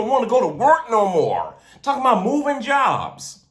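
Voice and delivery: a street preacher rallying believers; preaching. Acted. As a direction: preach it with full conviction to the congregation. Preaching resumes after aside.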